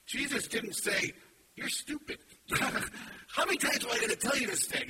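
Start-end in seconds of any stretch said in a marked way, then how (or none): 1.5-4.9 mock scolding. Imagined Jesus scolding Martha, mock exasperated. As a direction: playfully act out the scolding Jesus didn't give. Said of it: "He didn't scold her".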